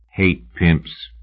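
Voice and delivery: dead, toneless statement. A narrator's reading. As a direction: state it without any feeling. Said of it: "he announced tonelessly".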